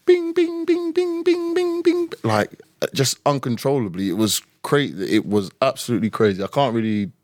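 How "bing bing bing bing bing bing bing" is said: high, rapid string of notification pings; ping like a phone flooded with alerts; imitated phone pings. Contestant mimics his phone going off uncontrollably, high pinging. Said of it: "it's just like".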